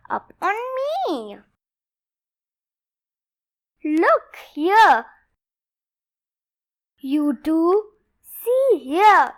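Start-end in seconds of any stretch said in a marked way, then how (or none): none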